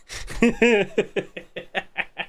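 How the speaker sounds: high breathy laugh; amused laugh. Real, not acted. Laughing at frog cosplay.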